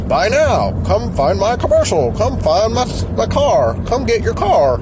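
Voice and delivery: high, loud hammed-up car dealer pitch; cheesy car salesman character. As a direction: shout it like a cheap local car ad. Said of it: "I really like that, that character".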